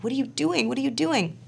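high, repeated alarmed questioning; mock alarm, pitched right up; retold alarm. Wife watching husband rip wires open, alarmed.

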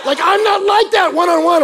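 loud, high-pitched wail; hammed-up shouted complaint; comic protest. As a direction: yell it in exaggerated dismay. Comic protest about own social awkwardness.

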